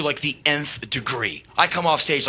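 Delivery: ordinary delivery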